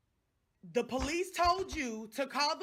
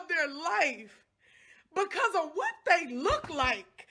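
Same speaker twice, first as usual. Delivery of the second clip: furious; 3 semitones higher, 5 dB louder than usual. Real, not acted. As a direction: pound each word out in real anger. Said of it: "I am pissed".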